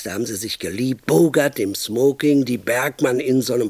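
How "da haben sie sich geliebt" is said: usual speaking voice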